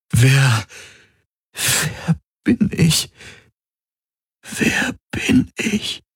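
put-on a frightened lost child asking. A narrator's reading. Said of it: "Er klang wie ein verlorenes Kind".

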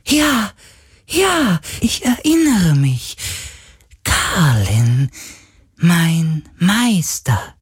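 very high, delighted spirit voice; elated otherworldly recognition; suddenly joyful. A narrator's reading. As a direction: rejoice at remembering, drawing the words out. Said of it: "sagte der Geist plötzlich freudig".

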